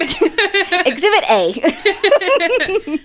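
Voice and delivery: high, slow, laughing self-mockery. Real, not acted. Host mocking her own awkward word to her co-host, laughing. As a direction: giggle it out at yourself.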